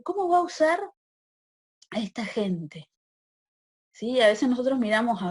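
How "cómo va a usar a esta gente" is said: sharp high disbelieving question, then slowing down; indignant prophet. Retold or imitated. Habacuc complaining to God, in high incredulous indignation. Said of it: "Y dice".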